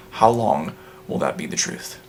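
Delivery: low, soft quoted retort; grave retort. Retold or imitated. Recalling their standing response to Doctor Edmund, grave and low. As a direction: pose the question low and quietly.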